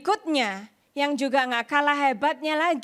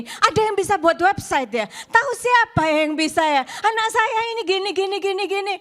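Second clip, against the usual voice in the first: higher, louder imitation of mother asking around; mother phoning around; 6 semitones higher, 5 dB louder than usual. Mother rings people seeking a website builder, imitated urgent asking. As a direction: re-enact urgent calls for help. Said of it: "Mama saya mulai telepon sana, telepon sini".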